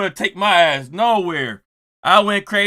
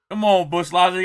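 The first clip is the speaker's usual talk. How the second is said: ordinary delivery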